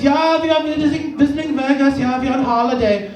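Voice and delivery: put-on comic German accent, lifted pitch at first. German tourist on holiday in Vegas, German accent. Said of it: "talk to a German tourist".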